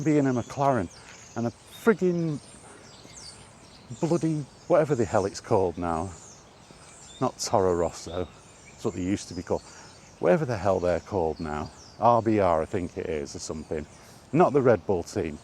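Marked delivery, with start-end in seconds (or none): none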